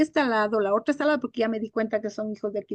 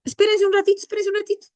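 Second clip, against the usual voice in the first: high-pitched, hurried plea to wait; hurried interruption; 9 semitones higher, 3 dB louder, faster than usual. Real, not acted. Teacher suddenly pausing her students mid-class, hurried and high. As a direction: break off suddenly, voice jumping up.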